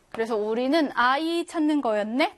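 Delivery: usual speaking voice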